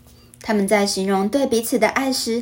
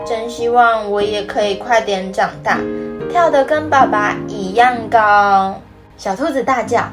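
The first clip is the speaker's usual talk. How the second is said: put-on reading to a toddler; 2 semitones higher than usual. Storytelling narration with character voices.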